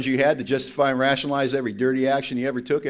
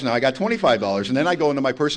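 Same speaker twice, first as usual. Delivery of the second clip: usual speaking voice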